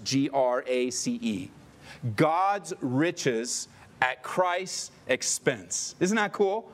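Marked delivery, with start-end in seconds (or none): 0.0-1.5 G R A C E spelled slowly, one letter at a time. Shown as a demonstration. Spelling G R A C E for the congregation. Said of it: "broke it down by its letters". 2.2-5.9 God's riches at Christ's expense, very slow and weighty; slow emphatic acronym. Shown as a demonstration. Pastor unpacking the acronym to the congregation, slow and weighty. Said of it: "broke it down by its letters".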